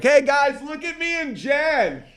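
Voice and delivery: very high, hollered show-off; attention-seeking call. Retold or imitated. Retold himself showing off at party.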